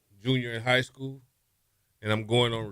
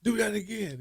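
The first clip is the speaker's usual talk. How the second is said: amazed coach; 11 semitones higher than usual. Retold or imitated. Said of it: "coach was like".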